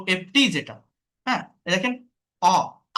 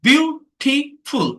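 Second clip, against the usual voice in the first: "Beautiful" in three separate, higher, louder beats; split into syllables; 6 semitones higher, 6 dB louder, much slower than usual. Shown as a demonstration. Teacher counting three syllables, "Beautiful" slowly split and punched. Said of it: "three syllable".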